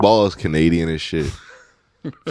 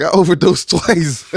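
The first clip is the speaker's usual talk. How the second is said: laughing brag; 10 semitones higher, slower than usual. Retold or imitated. Quoting a bragging friend.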